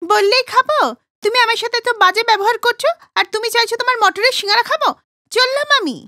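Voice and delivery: a woman snapping back, insulted; indignant woman. Acted. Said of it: "একটা মহিলা". (অপমানিত মহিলার চড়া গলায় জবাব)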